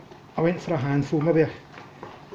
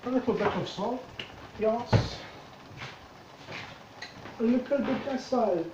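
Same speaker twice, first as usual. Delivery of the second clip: put-on playful Jamaican Caribbean accent, pitched higher; 7 semitones higher, 4 dB quieter than usual. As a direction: sing it out in a jokey Jamaican accent. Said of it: "That's me doing a wee bit of Jamaican Caribbean accent there".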